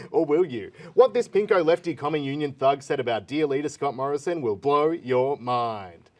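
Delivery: clickbait video narrator. Acted. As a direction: tease it like clickbait, stretch the end. Clickbait headlines, slowing at end.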